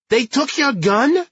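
high, slow indignant disbelief; outraged Brooklyn mother. Acted. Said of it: "She was outraged".

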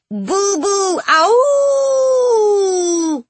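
high, long-held ghostly howl; eerie ghostly intoning. A narrator's reading. Children making ghost noises to lure her, eerie howl. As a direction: wail like a spook, rising then gliding down. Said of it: "they intoned".

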